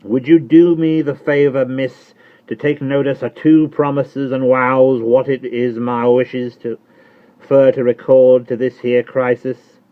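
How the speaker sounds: high, loud rough servant voice; Jerry Cruncher. Acted. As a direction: answer her like a loyal, plain-spoken servant. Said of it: "who was all in a tremble".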